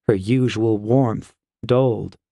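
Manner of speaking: ordinary delivery, nothing marked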